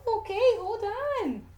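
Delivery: high, slow amused regroup; laughing at a stumble. Real, not acted. Reader laughs at tripping over a word, high and slow. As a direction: laugh off the fumble and reset. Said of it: "discusses the con".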